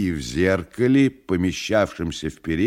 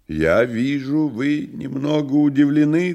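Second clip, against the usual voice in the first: loud, high, smooth stranger; a lightly voiced sinister guest; devil's voice; 9 semitones higher, 5 dB louder than usual. Woland speaks to Styopa.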